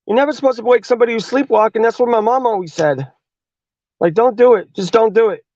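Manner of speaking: put-on high, urgent kid pleading; panicked kid. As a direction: beg him to stop, voice way up. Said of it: "And Ted's like".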